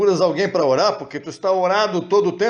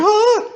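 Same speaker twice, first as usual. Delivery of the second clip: mock startled gasp; 5 dB louder than usual. Startled reaction to bad news.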